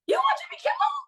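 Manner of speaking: shrieking high excited cry, very high pitched; mom screaming. Retold or imitated. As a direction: scream it like a thrilled mom seeing her kid on TV.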